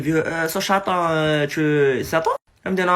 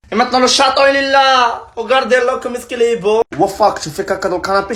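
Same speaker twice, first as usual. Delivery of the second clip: excited; 7 semitones higher, 8 dB louder than usual. Real, not acted. Shows off new kitten excitedly.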